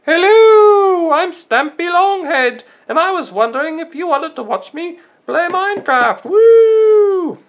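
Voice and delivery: very high, loud cartoon voice, drawn out; piercing, over-excited falsetto greeting ending in a long whoop; Stampy Longhead. YouTuber impression for drawing viewers, very high and excited. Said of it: "This is Stampy".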